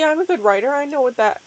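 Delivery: ordinary delivery, nothing marked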